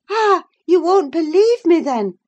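high, loud, falling away at the end; affronted retort. Acted. Catherine quarrels with Isabella.

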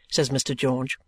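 ordinary delivery

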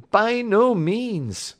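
"By no means" high, loud and drawn out; emphatic denial. A narrator's reading. Patient corrects the doctor, "By no means" high and emphatic. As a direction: stretch "By no means" slowly at a high, loud pitch. Patient denies it was an accident.

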